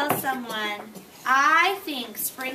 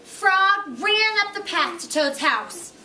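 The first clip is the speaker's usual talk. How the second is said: put-on reading to children; 5 semitones higher, 5 dB louder than usual. A narrator's reading. Teacher reading Frog and Toad to the class, storytelling voice. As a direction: read it out big for the little ones.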